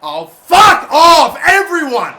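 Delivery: very loud, high, slow furious roar; raging shout. Real, not acted. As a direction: roar it at everyone. Raging after a clearance.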